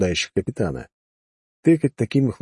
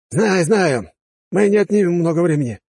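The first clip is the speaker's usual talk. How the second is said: dismissive captain; 9 semitones higher, 4 dB louder than usual. Acted. Captain brushes him off.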